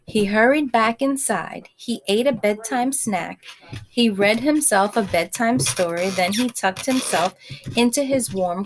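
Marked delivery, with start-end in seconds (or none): none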